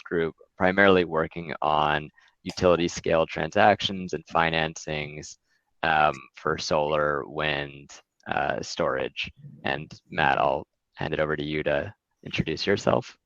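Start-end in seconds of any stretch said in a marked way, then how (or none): none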